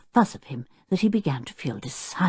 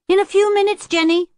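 put-on higher boy calling back; called back; 5 dB louder than usual. A narrator's reading. Tinker to Jenny, putting her off, high called answer. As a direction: call it over your shoulder. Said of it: "called back Tinker".

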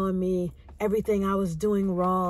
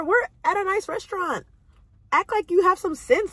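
retold scolding; 6 semitones higher than usual. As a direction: scold him like a strict auntie. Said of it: "I was like".

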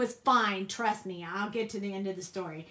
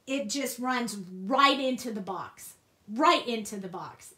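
ordinary talk

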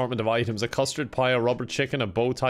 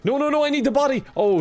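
high, fast, loud panic; panicked shout; 12 semitones higher, 6 dB louder, faster than usual. Real, not acted. Player shouting in panic as a guard approaches, high and fast. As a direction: blurt it out in alarm.

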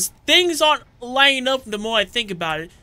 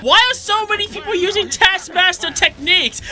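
frustrated cry; 6 semitones higher than usual. Real, not acted. Frustrated by game enemies.

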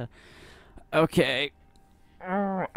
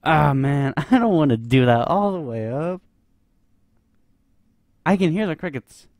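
higher, louder, laughing over the blast of sound; loud startled laughter; 6 semitones higher, 10 dB louder than usual. Real, not acted. Startled by loud crickets, laughing exclaim.

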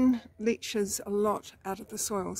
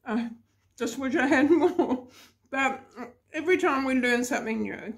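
laughing through the words; 4 semitones higher, 3 dB louder than usual. Real, not acted. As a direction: giggle while you talk. Laughing at herself while eating.

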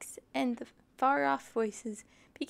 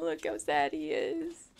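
ordinary delivery

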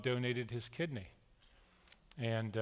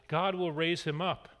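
usual speaking voice

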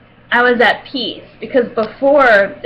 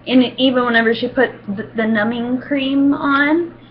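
normal talking voice

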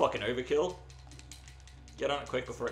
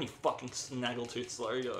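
ordinary talk

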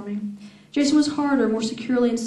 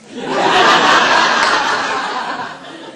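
louder airy laugh at her own reading; real breathy laugh; 9 dB louder than usual. Real, not acted. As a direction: crack up at the line. Reader laughs at the line.

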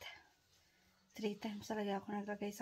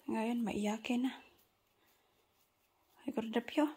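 usual speaking voice

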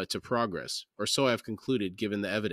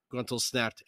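ordinary delivery, nothing marked

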